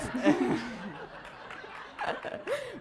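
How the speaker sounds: breathy laugh at her own slip; sheepish giggle after a mix-up; embarrassed laugh. Real, not acted. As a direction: laugh it off, caught out. Embarrassed at wrong slide.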